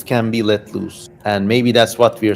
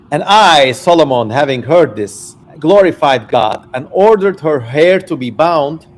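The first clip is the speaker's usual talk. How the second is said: put-on raised, declaiming reading of old scripture; dramatic reading; 2 semitones higher, 5 dB louder than usual. Streamer reads Solomon's testament to chat, declaiming. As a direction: read it out like a solemn proclamation. Reads ancient text aloud.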